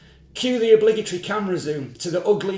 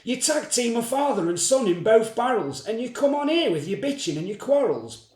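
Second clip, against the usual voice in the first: Jezza shouting; 3 semitones higher than usual. Acted. Host yelling at the foul-mouthed woman, angry shout. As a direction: tear into her for her attitude. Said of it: "Jezza shouts".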